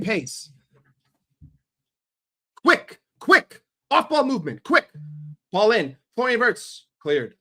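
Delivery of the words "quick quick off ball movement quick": high, loud urgent calls; frantic fan urging speed; urgent yelling. Real, not acted. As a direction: yell at them to hurry up.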